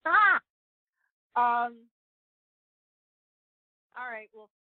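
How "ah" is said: high, loud "Ah!"; startled exclamation. Real, not acted. Guest hit with the big question about God, startled exclamation. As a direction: let out a sudden high cry at the question.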